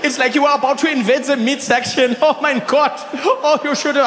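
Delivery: put-on high, German-accented amused jeering; imitating a German friend in stitches; laughing tease. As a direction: keep teasing through laughter. Rolf still laughing at him.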